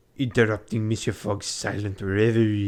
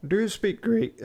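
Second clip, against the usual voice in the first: much higher, strained posh attempt; odd strained voice; 12 semitones higher than usual. Fogg asks Passepartout about Greek, strained high voice. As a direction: reach for the posh voice and overshoot. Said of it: "sorry, wrong voice".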